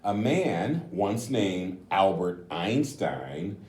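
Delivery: gentle, slowed storybook reading; reading to children. A narrator's reading. Reads the picture book aloud.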